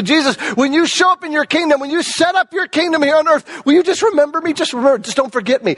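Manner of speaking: high, pleading plea of a desperate thief. Retold or imitated. Thief begging Jesus, voiced by preacher.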